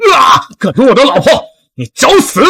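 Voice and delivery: high, strained fighting yell; enraged, high-pitched outburst; furious shout. Acted. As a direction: roar at the attacker while striking. Husband attacks the kidnapper in fight.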